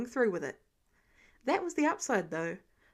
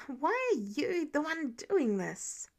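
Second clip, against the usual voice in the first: through gritted teeth; 4 semitones higher, slower than usual. A narrator's reading. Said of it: "Peter gritted his teeth".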